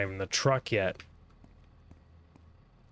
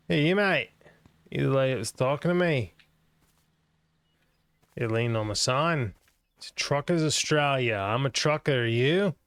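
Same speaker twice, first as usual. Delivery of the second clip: mock Aussie accent; 4 semitones higher than usual. Streamer greets a game character in a mock Australian accent. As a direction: hail the stranger like a cheeky Australian.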